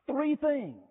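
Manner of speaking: "Three" lifted high with a falling close on "things"; stress on THREE. Shown as a demonstration. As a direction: hit the word "Three" high, then let it fall. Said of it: "three things converged".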